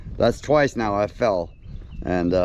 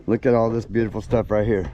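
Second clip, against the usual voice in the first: ordinary talk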